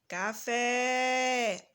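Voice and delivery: "Café" called out, long held "fé"; calling out the word. Shown as a demonstration. Teacher showing the class the stressed syllable, "Café" called out with a long "fé". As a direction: call "Café" as if hailing someone, stretching the last syllable. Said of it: "pronunciar como se estivesse chamando a palavra".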